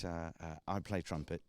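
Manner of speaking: ordinary talk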